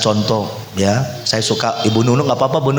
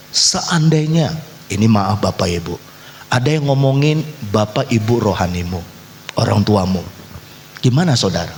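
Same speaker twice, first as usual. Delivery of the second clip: ordinary talk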